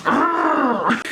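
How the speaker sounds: high, loud imitated "argh"; hammed-up yell of fighting back; mock battle scream. Imitates her blasting him back.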